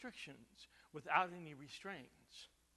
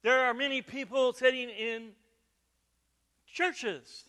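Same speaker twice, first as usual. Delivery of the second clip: preaching at full force; 5 semitones higher, 11 dB louder than usual. Acted. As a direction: drive it home to the pews. Pastor preaching to congregation.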